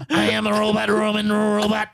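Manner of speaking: even machine gun syllables; robot voice. Retold or imitated. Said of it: "Mister Robot walked in".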